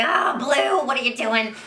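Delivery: loud, high, exasperated cartoon scolding; Frankie Foster. Shown as a demonstration. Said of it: "Frankie just sounds a lot like me".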